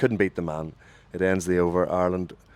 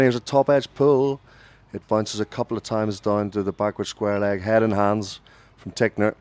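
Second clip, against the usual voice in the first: sudden lift into a louder call; urgent radio cricket commentary; live play-by-play; 5 dB louder than usual. Real, not acted. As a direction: break off to call the shot, then settle.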